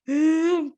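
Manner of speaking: a gasp before crying. Retold or imitated. Gasps while telling friend about crying.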